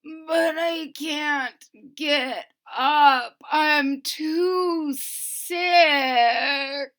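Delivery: slow sick crying moan; very slow weepy moan. Acted. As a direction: drag it out, too ill to move. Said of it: "moaned Wilbur".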